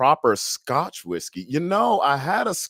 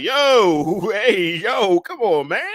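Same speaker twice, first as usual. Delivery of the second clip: excited retelling; 8 semitones higher, 4 dB louder, much slower than usual. Retold or imitated. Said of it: "I was like".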